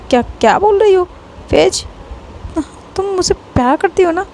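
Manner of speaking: high, hurt disbelief; can't believe what she hears; shocked disbelief. A narrator's reading. Lovely asks Faiz in shocked disbelief, pitched high.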